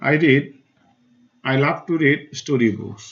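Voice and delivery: "I read" hit loud, then "story books" pressed in a slow read; stressed words read slowly. Shown as a demonstration. Teacher reads the book sentence to pupils, stressing the bold words. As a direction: punch the opening, then lean on "story books". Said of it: "The stressed words are marked in bold".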